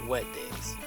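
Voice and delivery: ordinary delivery, nothing marked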